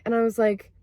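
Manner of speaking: ordinary talk, nothing marked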